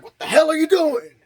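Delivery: high, loud, fast startled yell; mock shout. Sheriff startled by Tommy, mock shout. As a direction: bark it at the intruder.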